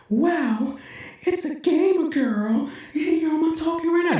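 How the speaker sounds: very high, squeaky kid impression; TikTok gamer kid. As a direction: imitate an awestruck kid in a drawn-out falsetto. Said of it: "This is how he was talking".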